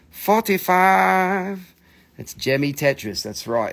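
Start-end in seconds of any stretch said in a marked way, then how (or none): 0.1-1.7 slow, higher, louder forty-five; drawn out. Shown as a demonstration. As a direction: stretch out each syllable of Forty-five. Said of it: "this one is a forty-five".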